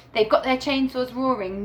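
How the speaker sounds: ordinary delivery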